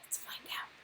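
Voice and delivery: ordinary delivery, nothing marked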